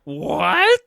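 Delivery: very high, loud incredulous yelp; piercingly high rising exclamation; mock shock. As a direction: echo it in hammed-up disbelief. Co-host echoes the mock shock.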